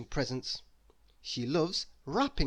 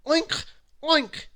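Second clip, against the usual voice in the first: high, louder piggy oinks; 13 semitones higher, 6 dB louder than usual. Acted. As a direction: snort it out like a pig. Doorbell makes a pig noise.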